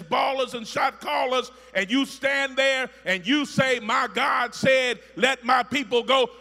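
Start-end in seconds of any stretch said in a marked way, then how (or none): 3.8-6.3 proclaiming to the king. Acted. Preacher voicing Moses before king.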